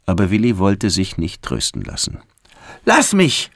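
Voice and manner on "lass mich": high, upset outcry; high-pitched boy's protest; child's yell. A narrator's reading. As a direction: cry out to be left alone. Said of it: "schrie er".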